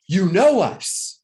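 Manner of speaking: slow, high and loud amazement; amazed. Retold or imitated. Coach imitates locals amazed he guessed, slow and loud. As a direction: marvel as if he read their minds.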